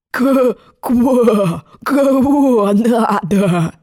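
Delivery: slow, drawn-out froggy croak; hoarse croaking frog. Acted. Old frog answering at the door, slow hoarse croak. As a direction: croak hoarsely, stretching the vowels. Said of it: "хрипло квакнул он".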